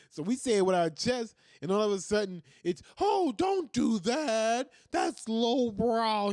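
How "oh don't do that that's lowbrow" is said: high, loud, drawn-out mock scolding; hammed-up scandalized protest; mock outrage. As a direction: wail the objection mockingly, dragging every word. Mocks objectors' sudden outrage.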